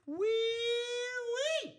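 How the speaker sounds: high, squealed pig call. Acted. Host calling viewers like piggies to the trough, squealing.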